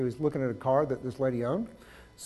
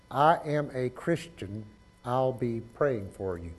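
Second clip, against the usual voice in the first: slow word-by-word count; 2 semitones lower, 5 dB quieter, slower than usual. Shown as a demonstration. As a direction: say "I'll be praying for you" slowly, word by word. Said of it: "How many is that?".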